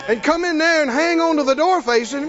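loud, fervent preaching. Real, not acted. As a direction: preach it with fire. Preaching at full force.

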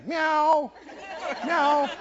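high mewing cat imitation; playful high kitty meows. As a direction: meow like a stray cat. Cat back every day.

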